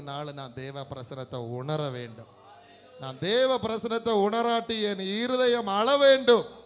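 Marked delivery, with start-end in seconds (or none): 3.0-6.6 loud, high, fervent exhortation; full-force preaching. Real, not acted. Preacher urging believers to weep for God's presence, full-force preaching. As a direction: drive it home at full volume.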